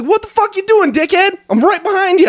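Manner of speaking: high, mock-furious Bostonian; angry Boston accent. Retelling a drunken run-in at the gate, angry Boston accent. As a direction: go off on him like an irate guy from Boston. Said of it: "going off on me in his Boston accent".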